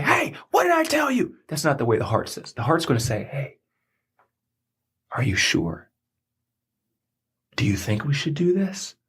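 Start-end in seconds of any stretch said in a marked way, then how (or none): 0.0-1.4 mock heart yelling. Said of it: "Your heart ain't gonna be like". 3.2-9.0 whisper. Retold or imitated. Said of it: "It's gonna whisper".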